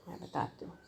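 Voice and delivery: real hushed aside, almost voiceless; whispered aside. Real, not acted. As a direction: drop to a whisper for someone off to the side. Off-mic aside during class. (धीमी फुसफुसाहट में किनारे कही बात)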